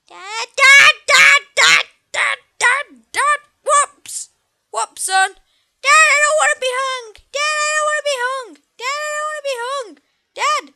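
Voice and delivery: put-on a boy begging his dad to save him; panicked child pleading. Son begging his dad not to let him be hung, in a frantic, high kid voice.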